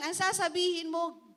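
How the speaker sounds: usual speaking voice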